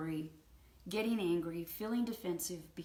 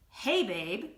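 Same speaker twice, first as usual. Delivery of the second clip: loud, pointed call to a partner; accusatory; 8 dB louder than usual. Retold or imitated. Wife confronting husband in the kitchen, accusatory. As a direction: say it as an accusation, not kindly. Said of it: "in the accusatory way".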